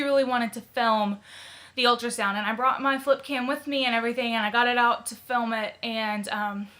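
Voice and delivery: normal talking voice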